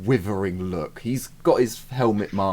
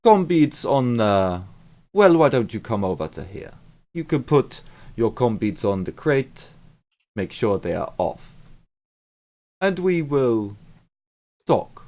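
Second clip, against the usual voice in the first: a bit louder, clumsy fake South African accent; 4 semitones higher, 4 dB louder than usual. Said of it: "worst fake South African accent".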